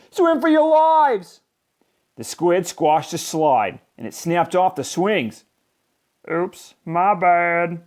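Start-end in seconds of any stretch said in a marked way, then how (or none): 0.1-1.4 high, loud, slow panic; panicked scream. A narrator's reading. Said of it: "cried Joey Mackerel". 6.3-7.8 sheepish squid. A narrator's reading. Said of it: "said the new kid".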